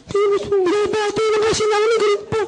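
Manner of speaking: put-on very high-pitched child voice; hurt child. Child dragged into a parent's anger, upset. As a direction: protest in a squeaky kid's voice. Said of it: "Pati bata nadamay".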